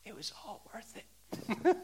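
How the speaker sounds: slow soft repeat of the line; hushed awed quote. Retold or imitated. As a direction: say it again, gently amazed. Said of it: "the girl said it again".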